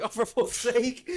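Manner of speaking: loud, high mock exasperation; high, drawn-out comic groan of exasperation; exasperated outburst. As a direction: drag it out loudly in comic despair. Retold exasperated crowd work reaction.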